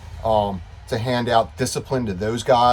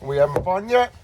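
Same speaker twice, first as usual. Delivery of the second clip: playful silly voice; 9 semitones higher, 6 dB louder, much slower than usual. Pretend. Silly greeting at start of video.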